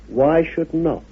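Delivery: usual speaking voice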